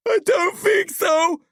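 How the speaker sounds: high, slow, tearful cry; sobbing shout. A narrator's reading. Billy answers his rescuers from the hole, sobbing. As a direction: wail it slowly in high distress. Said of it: "Billy sobs".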